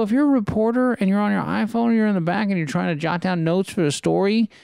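high, insistent retold reprimand; retold exasperated telling-off. Comic lecturing man in front row, exasperated. Retold confrontation with audience member.

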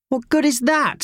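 high, loud squawk; a child's indignant squawk. A narrator's reading. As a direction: squawk it out in protest. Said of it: "squawked Howard".

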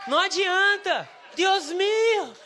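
high, drawn-out shout to heaven; mock prayer cry. Preacher parodying a prayer for languages, hammed-up pleading shout. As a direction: wail it loudly, stretching the words.

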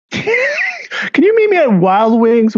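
high, slow hammed-up come-on; slow, high teasing put-on voice; mock escort pitch. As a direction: camp it up way high like a flirty invite. Joking escort phone pitch.

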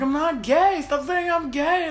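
high, upset protest, mildly acted; retold tearful protest. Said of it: "getting emotional and crying".

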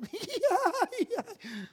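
high-pitched comic shriek of fright; mock terrified squeal. Preacher joking about his dread of snakes, mock terrified squeal. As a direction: squeal with laughing, hammed-up terror. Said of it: "me ha causado un terror".

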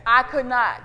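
usual speaking voice

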